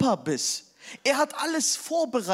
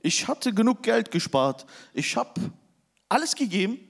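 normal talking voice

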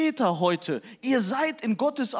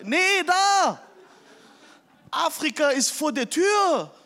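higher and louder mock shout; wife's outburst; 7 semitones higher, 4 dB louder than usual. Wife pointing at the flood on TV, loud. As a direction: point and insist.

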